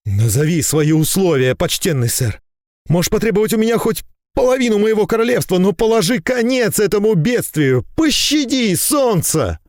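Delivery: high, urgent royal plea; desperate pleading king. A narrator's reading. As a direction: beg for the sun to be spared. Король молит остановить затмение. (отчаянная мольба короля)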